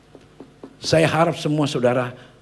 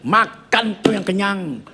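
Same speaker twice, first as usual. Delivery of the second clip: high, slow, drawn-out mock scolding; retold angry outburst; 8 semitones higher, 3 dB louder, much slower than usual. Mother slamming food down before her son, acted angry scolding. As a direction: act out an angry mom, stretching the words. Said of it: "kok mami marah-marah".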